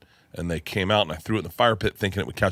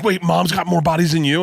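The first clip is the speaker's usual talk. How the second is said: higher, louder astonished question; shocked teasing; 9 semitones higher, 5 dB louder than usual. Retold or imitated. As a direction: gasp it out teasingly. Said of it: "they're like".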